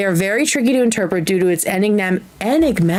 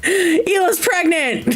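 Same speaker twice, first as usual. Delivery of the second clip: laughing; 9 semitones higher, much slower than usual. Real, not acted. Laughing shock at dream meaning.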